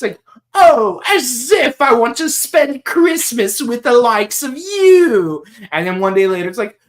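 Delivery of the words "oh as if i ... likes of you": lightly whiny brat, higher. Retold or imitated. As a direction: sneer it like a spoiled kid. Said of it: "that whiny voice he has".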